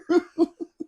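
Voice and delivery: squealing laugh up high; real helpless giggle; high burst of laughter. Real, not acted. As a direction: break into high-pitched laughter. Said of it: "I immediately burst out laughing".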